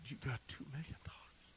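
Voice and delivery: hushed, breath-only question; stunned whisper. Retold or imitated. Pastor to the stranger offering a loan, stunned whisper. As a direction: whisper it in disbelief.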